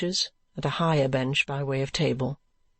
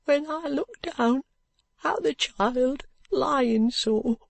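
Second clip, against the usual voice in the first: higher, slow, grieving brickmaker's wife; higher voice of a mother close to crying; tearful poor mother; 11 semitones higher, slower than usual. Acted. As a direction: near tears over her sleeping baby. Her eyes filling with tears.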